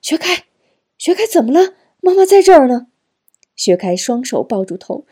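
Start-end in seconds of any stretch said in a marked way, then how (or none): 0.0-2.9 high, loud, urgent calling; alarmed mother. A narrator's reading. Mother runs to hold her screaming son, loud high alarm. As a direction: call out in alarm to your hurting child.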